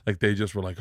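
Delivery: ordinary talk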